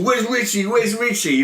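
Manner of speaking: high, hammed-up manic demand; put-on crazed movie tough guy; mock psycho mood. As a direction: imitate a killer hunting someone. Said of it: "he's in a psycho mood".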